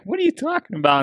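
high incredulous question, imitating himself; disbelief. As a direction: squeak out the disbelief. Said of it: "I'm like".

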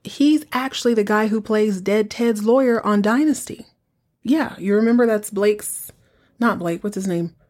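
normal talking voice

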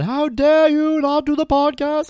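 high, loud, drawn-out outrage; hammed-up melodramatic scolding; Joan Crawford. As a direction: shriek it like a tyrannical movie mother. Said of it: "like I'm Joan Crawford".

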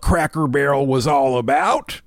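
hammed-up Southern grumble about tradition; mock Southern accent. Host acts out an angry commenter, mock Southern accent. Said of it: "offensive Southern accents".

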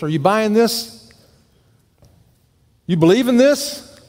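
normal talking voice

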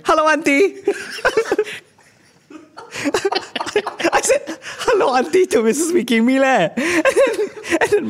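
laughing. Real, not acted. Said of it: "laughing while retelling greeting".

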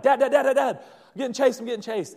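high, loud, rapid cry for Dad; panicked child. Retold or imitated. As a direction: act out a kid calling for rescue. Child voiced, fleeing dog to father.

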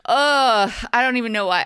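exasperated groan, then disbelief; loud fed-up ugh; annoyed ugh. Real, not acted. Groaning over a bad sequel.

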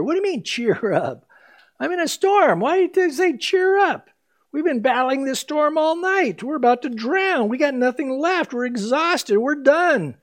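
high, pleading protest; exasperated disciple. Retold or imitated. Disciple in the storm answering Jesus: high, exasperated pleading. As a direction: whine it like a worn-out sailor.

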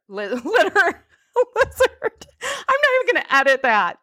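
high, giggly stumble over a word; laughing at own slip. Real, not acted. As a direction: try to correct yourself through real laughter.

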